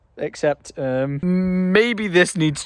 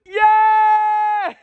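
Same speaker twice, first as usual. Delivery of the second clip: high, loud cheer; real victory whoop; joyful shout; 13 semitones higher, 5 dB louder than usual. Real, not acted. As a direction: yell it out in triumph. Cheering lit tree.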